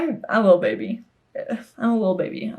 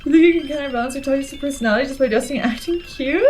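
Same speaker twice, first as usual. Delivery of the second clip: high-pitched, giggly read-aloud; real laughter breaking into the lines; laughing while reading; 5 semitones higher than usual. Real, not acted. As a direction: struggle to read through the giggles. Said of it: "I know I shouldn't laugh".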